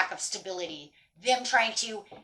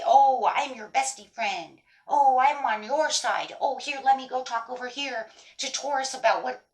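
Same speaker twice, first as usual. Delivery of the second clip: mock friendly; 4 semitones higher, 3 dB louder than usual. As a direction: imitate a two-faced friend sucking up. Mocks a two-faced friend's pretence.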